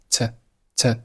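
"t" as a short, unstressed weak "to"; weak form. Shown as a demonstration. Teacher showing learners the weak form, reduced "t". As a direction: reduce "to" to a light "t" with barely a vowel. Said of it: "to是弱读".

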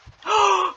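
high sharp intake of breath; quick frightened gasp; startled gasp. Real, not acted. Monster appears suddenly.

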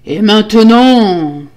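loud, long-drawn menacing roar. A narrator's reading. Husband at the terrible door, triumphant roar. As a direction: roar it slowly, stretching the end. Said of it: "rugit-il".

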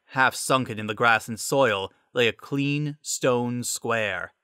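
ordinary delivery, nothing marked

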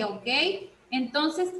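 ordinary talk

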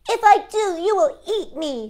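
high, doubtful cookie; suspicious gingerbread man. Acted.

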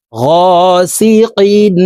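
long গা, then a sung -sikin; stretched vowel. Shown as a demonstration. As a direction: chant গাসিকিন slowly, drawing out the first vowel. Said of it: "এক আলিফ টানিয়া পড়িতে হয়".

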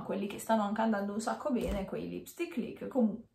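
usual speaking voice

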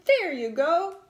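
loud, high roar of a bossy little man. A narrator's reading. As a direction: bellow it at the boy. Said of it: "roared the little man".

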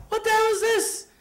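high, scandalized exclamation; acted indignant outburst; father's outraged shock. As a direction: repeat the demand in appalled disbelief, voice way up. Father repeats his outraged shock.

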